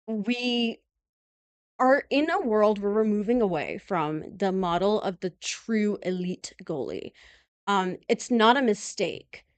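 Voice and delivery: usual speaking voice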